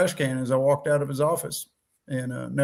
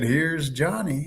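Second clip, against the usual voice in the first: movie quote; 4 dB quieter, much slower than usual. Retold or imitated. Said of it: "come in there and said".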